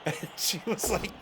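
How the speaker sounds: ordinary talk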